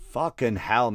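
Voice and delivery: ordinary talk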